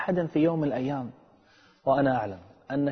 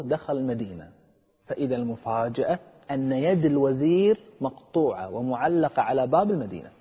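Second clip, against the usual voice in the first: ordinary talk, nothing marked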